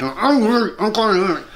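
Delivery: jaw clamped shut, words pushed through teeth; through wired teeth. Shown as a demonstration. Showing how he spoke with a wired jaw, teeth clenched. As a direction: talk without opening the jaw. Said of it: "I'm saying this through wired teeth".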